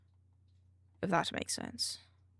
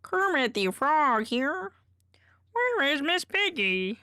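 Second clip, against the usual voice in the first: a light, breathy hint of Kermit, higher and louder; Kermit impression; 7 semitones higher, 6 dB louder than usual. Streamer showing off her Kermit impression, lightly voiced frog. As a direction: lightly do the frog puppet calling out. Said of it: "I have a banger Kermit impression".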